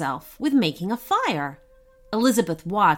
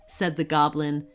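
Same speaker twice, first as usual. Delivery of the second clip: usual speaking voice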